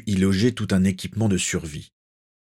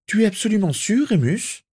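high-pitched voice of a worried mother; anxious questioning; 13 semitones higher than usual. A narrator's reading. A mother asks Remus to confirm the news, high and anxious. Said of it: "interrogea Molly Weasley".

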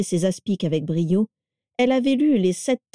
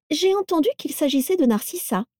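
higher, sugary, falsely sweet voice; honeyed voice; 9 semitones higher than usual. A narrator's reading. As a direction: fake sweetness over spite. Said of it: "d'une voix mielleuse".

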